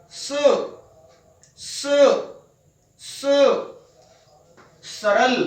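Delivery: hissed स with tongue toward the teeth; dental sa. Shown as a demonstration. Teacher showing the third sibilant, careful pronunciation. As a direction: say स and सरल with a clear dental s. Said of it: "जीभ दांतों की तरफ जाएगी".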